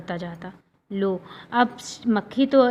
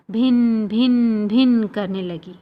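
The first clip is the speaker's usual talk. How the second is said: slow, stretched bhin bhin like a fly; drawn-out buzzing; 4 dB louder, slower than usual. Acted. Teacher mimicking a fly's buzz for children. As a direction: make the fly buzz by the ear.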